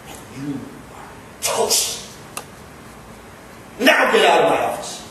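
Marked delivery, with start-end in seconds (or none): 0.1-2.0 soft whispery threat; quiet menace. Acted. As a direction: drop it to a hiss. Boss threatens employee.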